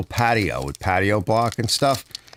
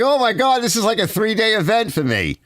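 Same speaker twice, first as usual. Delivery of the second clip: exclaimed disbelief; 13 semitones higher than usual. Retold or imitated. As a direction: play up the overwhelmed disbelief, pitched way up. Recalls dismay at two-hour video.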